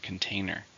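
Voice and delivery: "Container" with the weight on TAIN; stress on second syllable. Shown as a demonstration. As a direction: lean on the middle syllable of "Container". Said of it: "stress in the second".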